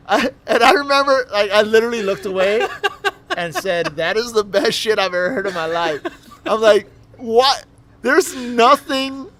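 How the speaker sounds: laughing hard while telling it. Real, not acted. Laughing at the absurd story.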